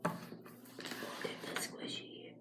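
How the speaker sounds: breathy whisper to the camera. Real, not acted. Whispering to viewers while showing the squishy. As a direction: hush it like a secret to viewers.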